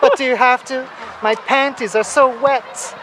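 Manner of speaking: lightly imitating a clingy girl; pleading girl voice.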